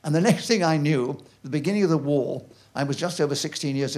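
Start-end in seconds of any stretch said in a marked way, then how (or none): none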